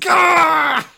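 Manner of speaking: high, loud strangled "gah!"; mock anguished yell. Podcaster lamenting a lost fourth season, mock anguished yell. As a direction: cry out in exaggerated frustration. Mock outburst at show's cancellation.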